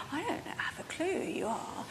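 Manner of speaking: quiet aside of panic; inner thought. Retold or imitated. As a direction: think it to yourself, hushed.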